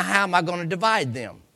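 normal talking voice

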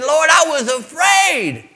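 high, loud and slow plea; Adam pleading before the Lord; frightened cry. Acted. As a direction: cry out in fear. Adam confessing to God.

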